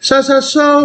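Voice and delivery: high-pitched imitation of clueless Canadians; high, held-out parody of a Canadian; mock Canadian. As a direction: put on a high mocking voice for Canadians. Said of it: "most Canadians are going".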